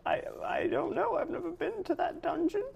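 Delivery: put-on high old woman voice; a timid granny, up high; old lady voice. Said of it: "She says".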